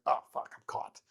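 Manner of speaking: retold under-the-breath curse; low-volume, sinking realisation; hushed dismay. Pilot to himself on being caught, hushed dismay.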